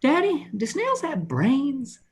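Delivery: put-on high, slow kid voice; young son's question. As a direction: imitate a little boy asking Daddy. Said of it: "He asked me the other day".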